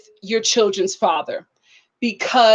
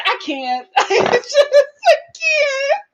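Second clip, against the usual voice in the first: laughing too hard to talk; 13 semitones higher, slower than usual. Real, not acted. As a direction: crack up mid-sentence. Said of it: "laughing helplessly".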